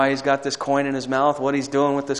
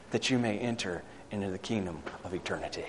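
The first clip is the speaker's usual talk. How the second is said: usual speaking voice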